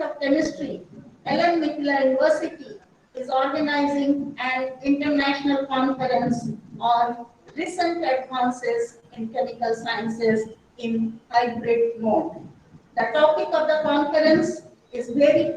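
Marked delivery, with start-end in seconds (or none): none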